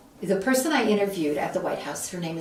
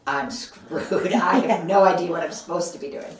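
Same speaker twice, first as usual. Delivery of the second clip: rueful laugh into a louder admission; laughing panic; 4 dB louder than usual. Retold or imitated. Alone inside the gate, laughing at being lost. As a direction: laugh at your own panic.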